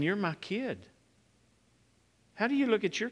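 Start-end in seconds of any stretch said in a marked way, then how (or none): 0.0-0.8 soft, loving declaration; a gentle father claiming his child; tender father. Retold or imitated. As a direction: say it like a proud parent. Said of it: "he's saying".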